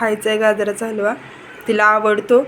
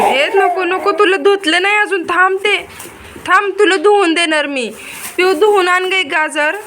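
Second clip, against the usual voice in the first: urgent stopping; 9 semitones higher than usual. Real, not acted. Mother rushing to stop her baby from eating dirty carrots, high urgent.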